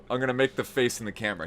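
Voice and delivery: normal talking voice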